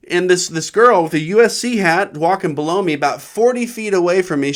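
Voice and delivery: ordinary talk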